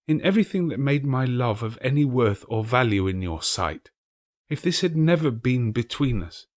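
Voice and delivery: lightly voiced grieving girl; young girl's voice. A narrator's reading. The girl tells Scrooge another idol replaced her, sadly.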